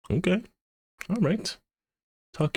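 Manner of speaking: squeaky-high little approval; playful high voice. Real, not acted. Animator reacts to the song credit, playfully high-pitched. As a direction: pitch it way up, same volume.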